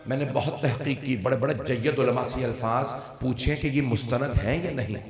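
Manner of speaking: ordinary talk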